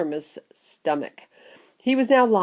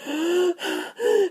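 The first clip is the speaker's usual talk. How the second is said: gasp; 12 semitones higher than usual. Acted. Boy gasping.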